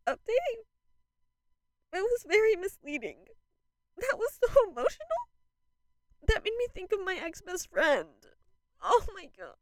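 very high-pitched, overwhelmed wail; emotional, losing composure. Real, not acted. Fan updating viewers after an emotional song, losing composure. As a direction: squeal it out as if about to sob. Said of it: "Okay, I've composed myself".

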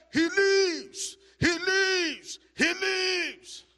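very high, loud exultant cry; triumphant shout. Retold or imitated. Preacher re-enacting a man's shout over the revived dead, triumphant shouting. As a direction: shout it out in victory, each cry held high. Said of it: "He shouted in his own way".

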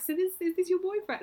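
high mock quote. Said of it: "people have been like".

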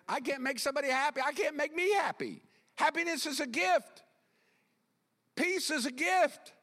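high, urgent pulpit declarations; impassioned preaching. Real, not acted. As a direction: proclaim it with pitch lifted right up. Preaches at raised pitch.

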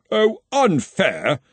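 slow indignant snap; snapping. A narrator's reading. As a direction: snap back, offended. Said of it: "snapped Henry".

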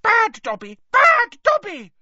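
loud squeal rising to a piercing shriek. A narrator's reading. Dobby punishes himself banging his head, rising squeal. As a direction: squeal in frantic self-punishment.

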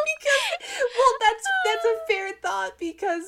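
high squeaky laughing talk; laughing hard. Real, not acted. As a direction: crack up while talking. Laughing fit mid-sentence.

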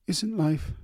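halting, high and breaking; stammering. A narrator's reading. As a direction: stumble over the words, emotional. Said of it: "she stammered".

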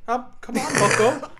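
earnest, aggrieved professor impression; Jordan Peterson. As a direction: rant like an indignant lecturing academic. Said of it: "I got the Peterson".

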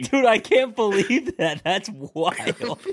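dude, I can't believe it, loud and amazed; stunned disbelief. Real, not acted. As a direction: let the shock stretch every word. Reacting to projectile puke story.

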